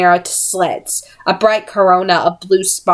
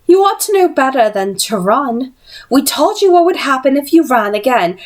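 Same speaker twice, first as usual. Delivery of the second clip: threatening villainess; 7 semitones higher than usual. Acted.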